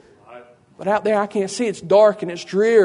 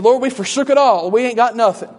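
high, loud acted grievance; disciple's aggrieved outburst, acted; complaining protest; 5 semitones higher, 5 dB louder than usual. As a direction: plead your case, voice pushed up.

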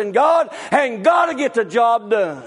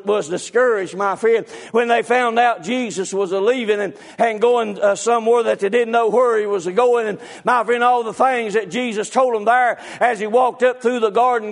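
ordinary delivery

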